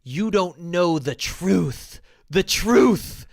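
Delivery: slow, preachy mock of a red pill commenter; lightly voiced smug troll. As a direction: drag out the truth like a smug guru. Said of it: "they're just like".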